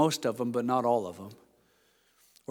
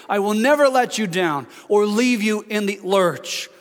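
I will not hammered higher and louder each time; emphatic triple negative; 5 semitones higher, 4 dB louder than usual. Acted. God's promise voiced by the preacher, thundering and emphatic. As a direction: pound the will not like a vow. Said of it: "three negatives, as if to say".

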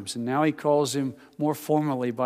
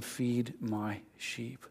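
much softer, slow; Jesus gently commissioning Peter; slow, soft command; 3 semitones lower, 11 dB quieter, much slower than usual. Retold or imitated. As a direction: lay it down gently, slowly. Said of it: "Jesus said to him".

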